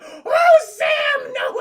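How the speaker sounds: very high, very loud wail; hammed-up anguish. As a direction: scream over the severed arm. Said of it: "she's like".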